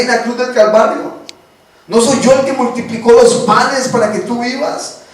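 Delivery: the Lord reassuring a fearful believer. Acted. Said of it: "llega el Señor y te dice".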